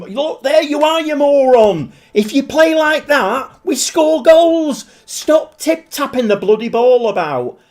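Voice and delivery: high, loud furious rant at the manager; angry fan shouting at the screen; screaming at the telly. Retold or imitated. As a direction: yell at Pep through the TV. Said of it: "I'm screaming at the telly".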